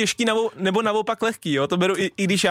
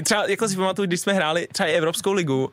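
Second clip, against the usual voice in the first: usual speaking voice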